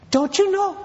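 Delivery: high, astonished don't you know; as if shocked they missed it; preacher's stunned rebuke; amazed question. Acted. Preacher voices Paul's amazement.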